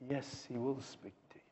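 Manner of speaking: soft, intimate whispered assurance; a whispered promise to the congregation. Real, not acted. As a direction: drop to a whisper to reassure them. Hushed affirmation after rhetorical question.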